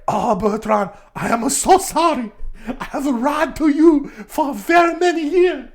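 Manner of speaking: put-on high accented voice, building in pitch; hammed-up confession. Shi confessing years of lies, mock-tragic high voice. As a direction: apologise in a put-on melodramatic character voice. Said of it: "And it's like".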